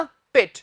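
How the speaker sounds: पिट with short i, pit; correct vowel. Shown as a demonstration. Teacher corrects the syllable, पिट with short i. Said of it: "पर बोलेंगे क्या?".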